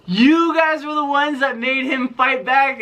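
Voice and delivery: high, slow imitation of a disbelieving grandma; shocked grandma. Grandma learns the grandkids coached Alex, mock high disbelief. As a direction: play her stunned realisation. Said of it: "she was like".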